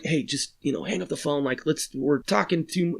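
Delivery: whisper-urge your friends to stop; urgent hush. Retold or imitated. Kid urging others to hang up.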